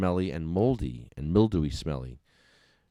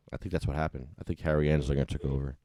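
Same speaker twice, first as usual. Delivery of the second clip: ordinary talk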